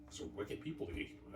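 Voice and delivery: hushed aside. Real, not acted. Hushed remark on gatekeepers.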